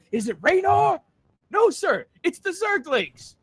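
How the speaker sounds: loud, high question and answer; mock urgent report. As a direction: report the absurd news in hammed-up alarm. Acting out report to horrified leader.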